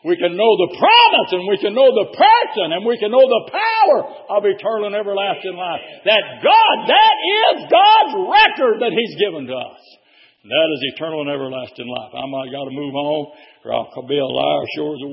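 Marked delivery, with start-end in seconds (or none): 0.1-9.8 loud, building preacher's call; rising sermon cadence; preaching at full force. Acted. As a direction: lift the church up with it.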